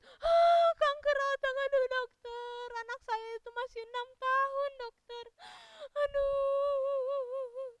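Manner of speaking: high, hammed-up sobbing wail; crying in fear. Mother tells the doctor her child is only six, wailing tearfully. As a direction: drag the words into a long high sob. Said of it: "Oh, nangis-nangis mamanya".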